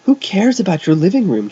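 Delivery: high, loud frantic scream. A narrator's reading. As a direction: scream it back, exasperated. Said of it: "Jeff screamed".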